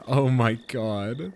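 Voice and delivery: slow, laughing oh my god; laughing disbelief. Real, not acted. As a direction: marvel through a laugh.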